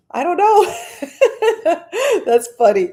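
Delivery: giggling through the words, high and loud; bright embarrassed giggle; laughing. Real, not acted. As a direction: laugh it off, flattered. Viewer comments on her hair.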